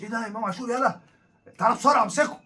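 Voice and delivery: mocking sensationalist hype, rising to a loud high call. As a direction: ham up a breathless trend channel grabbing the story. Mocking hype-chasing trend channels.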